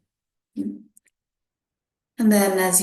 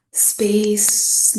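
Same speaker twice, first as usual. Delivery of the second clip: ordinary delivery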